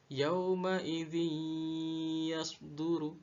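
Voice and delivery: YAUMA IDZI YASDURU slowly chanted, n merged into held y; merged idgham reading. Shown as a demonstration. Teacher reciting the second example, "yauma idzi yasduru" with long merge. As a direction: hold the hum between "idzi" and "yasduru". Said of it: "Begitu juga dengan contoh kedua".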